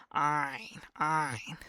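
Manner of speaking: "ein" with a wide mouth, from the throat; throaty ein. Shown as a demonstration. Teacher coaching the ein sound. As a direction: widen the mouth and push "ein" out. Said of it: "Widen your mouth a little bit".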